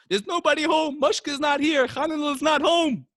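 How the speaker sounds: high, loud panicked denial; high-pitched, hammed-up shout; yelling. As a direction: yell it from behind a barred door. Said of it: "And Mushka yells".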